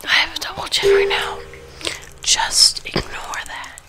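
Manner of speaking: ASMR whisper while eating; whisper, then chew loudly. Real, not acted.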